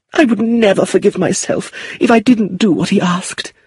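very high, fluttery worried mother; fretful anxious aunt. A narrator's reading. Lucilla fearing her son will shoot himself, fretful. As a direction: plead high-pitched, all in a fret.

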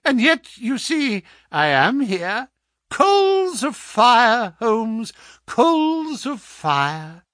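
high, loud, stretched-out mockery; gloating visitor. Visitor lords it over the helpless patient, gloating. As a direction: savour the phrase, drawing it out loudly.

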